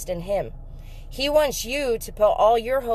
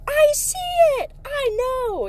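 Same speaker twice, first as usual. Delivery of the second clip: excited. Retold or imitated. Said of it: "he was like".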